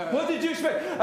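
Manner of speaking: laughing, teasing what did you expect; amused rhetorical question with a laugh. Retold or imitated. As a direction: chuckle through the question. Playful voicing of Jesus, laughing.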